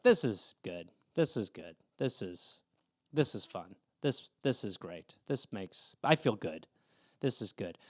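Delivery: very low, quiet mumbled repetition; small, deep, deflated voice; numb self-reassurance. Retold or imitated. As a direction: reassure yourself under your breath, low and soft. Stunned reaction after being mistaken.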